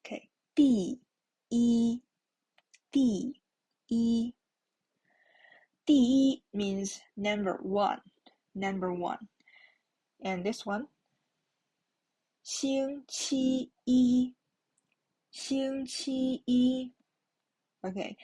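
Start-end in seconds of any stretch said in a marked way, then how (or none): 0.5-4.3 separated syllables, "一" on a level pitch; first-tone model. Shown as a demonstration. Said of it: "the tone is the first tone". 12.4-16.9 slow "星期一", each syllable high and level; stretched syllables ending in level "一"; first-tone model. Shown as a demonstration. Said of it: "the tone is the first tone".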